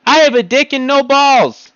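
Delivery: high, loud shouted confession; honest yell. Shown as a demonstration. Another mock-honest confession, yelled. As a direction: belt it out for all to hear. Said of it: "I can yell there".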